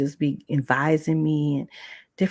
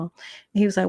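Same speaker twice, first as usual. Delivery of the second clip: normal talking voice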